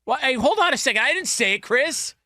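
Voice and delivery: urgent high protest. Real, not acted. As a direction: jump in to defend yourself. Defending himself to co-host.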